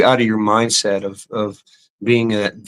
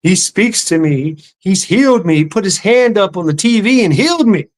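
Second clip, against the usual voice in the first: rising, ecstatic voice of a convinced believer; believer's rapture; 8 semitones higher than usual. Retold or imitated. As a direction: gush like she's been touched by God. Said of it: "she's like".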